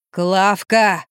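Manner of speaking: drawn-out, mildly howled name; howling. A narrator's reading. Drunk man calls for Klavka, mild acted howl. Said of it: "выл Юрий".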